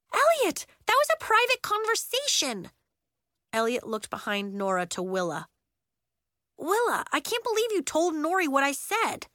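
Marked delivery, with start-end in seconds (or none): 0.1-2.7 much higher, louder rebuke; scolding. Acted. Nori scolding Elliot. 6.6-9.3 higher, disbelieving complaint; aggrieved. Acted. Elliot aggrieved at Willa.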